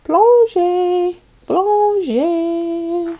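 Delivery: big excited storybook call; dramatic dive. Acted. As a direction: make the dive thrilling for the kids.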